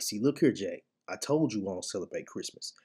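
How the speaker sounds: ordinary talk, nothing marked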